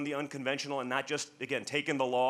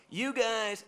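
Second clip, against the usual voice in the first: higher imitation of her yell; mock scream; 8 semitones higher than usual. Older lady calling out to him, higher mock yell. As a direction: echo her cry, pitched up. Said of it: "And she's screaming it".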